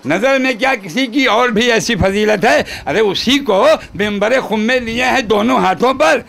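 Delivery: measured, projected mushaira-style recital; poem recitation. Acted. Poet reciting praise verse to gathering, declaimed. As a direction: declaim the couplet, lingering on line endings.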